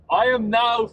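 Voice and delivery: high and loud, half-laughing; nervous laugh. Real, not acted. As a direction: voice jumping up with nerves.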